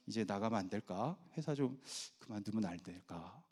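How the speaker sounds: low, soft, tentative plea; hesitant husband. Retold or imitated. Husband asking wife to let him quit, hesitant murmur. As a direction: timidly float the idea.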